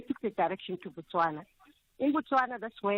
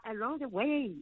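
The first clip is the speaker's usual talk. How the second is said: ordinary talk, nothing marked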